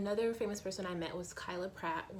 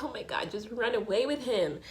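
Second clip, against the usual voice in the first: louder, frustrated urging; exasperated fan; 5 semitones higher, 5 dB louder than usual. Retold or imitated. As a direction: yell at the TV character. Reacting to show scene.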